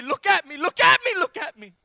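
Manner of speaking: loud, high, insistent call; urgent parent's shout. Acted. Parent to a child in pain, urgent shouting. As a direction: cry out to grab a child's attention, peaking midway. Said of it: "you've tried to get their face and say".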